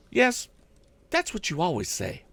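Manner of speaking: a worried housekeeper pleading with the inventor; housekeeper's voice. A narrator's reading. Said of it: "rejoined the motherly-looking woman".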